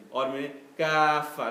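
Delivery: "or mean kaffa" with the vowels drawn out long and slow; long madd recitation. Shown as a demonstration. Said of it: "we do long ayah".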